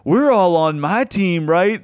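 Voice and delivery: high, slow appeal to a crowd; imitated heckler seeking backing; mimicked rallying call. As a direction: call it out, rounding up supporters.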